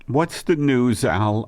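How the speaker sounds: ordinary talk, nothing marked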